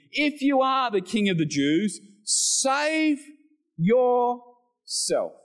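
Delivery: high, jeering call up at the cross; mocking taunt. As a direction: taunt him from the crowd, pitched high. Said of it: "Again, they're mocking him".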